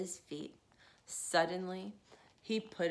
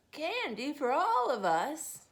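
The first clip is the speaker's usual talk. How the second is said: higher, louder, slower delighted ask; delighted question; 6 semitones higher, 6 dB louder than usual. Acted. Grandmother surprised by candy for all. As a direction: savor the surprise. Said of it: "asked grandmother".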